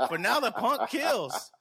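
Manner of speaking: high, loud, slow through real laughter; drawn-out words breaking into laughter; laughing. Real, not acted. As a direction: crack up while landing the punchline. Cracking up at own joke.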